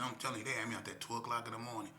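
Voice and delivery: usual speaking voice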